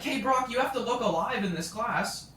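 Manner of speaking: mock a teacher calling out a slumped student; imitated yelling. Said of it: "I'm getting yelled at".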